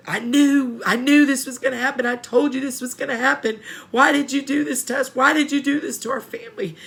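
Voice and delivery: imitating an upset relative, loud and high; accusing outburst. Family scolding a loved one in prison, accusing.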